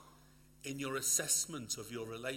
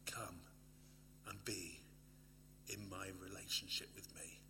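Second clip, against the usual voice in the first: tender whisper; 4 semitones lower, 8 dB quieter than usual. Acted. God's tender invitation, whispered.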